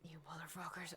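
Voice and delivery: whispered under the breath; exasperated whisper at the options; whispered curse. Real, not acted. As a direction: hiss it at the choices. Muttering at tough game choice.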